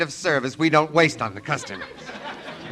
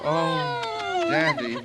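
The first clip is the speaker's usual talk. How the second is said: mock girlish squeal; 3 dB quieter, much slower than usual. Mocks a girl's squeal.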